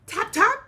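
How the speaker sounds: high, loud tap tap; tapping sound. A narrator's reading. Teacher to class, voicing the woodpecker's tapping loudly. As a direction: pop each tap up high.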